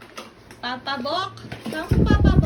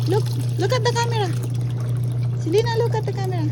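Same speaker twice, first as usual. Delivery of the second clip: quick urging; 5 semitones higher, 6 dB louder, much faster than usual. Real, not acted. Urging toddler to look.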